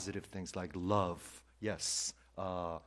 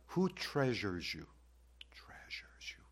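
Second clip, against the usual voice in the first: slow, unvoiced whisper; whispered question; slower than usual. Real, not acted. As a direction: lean in and whisper it. Intimate question to congregation.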